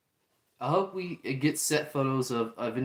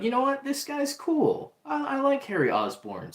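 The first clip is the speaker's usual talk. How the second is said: high, slowed-down mock delight; lightly voiced happy teen; won over Peter; 13 semitones higher than usual. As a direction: warm up to him as if pleasantly surprised. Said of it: "he started to be like".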